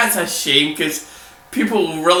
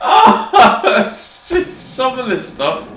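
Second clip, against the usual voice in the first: laughing; 5 semitones higher, slower than usual. Real, not acted. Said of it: "I can't stop laughing about it".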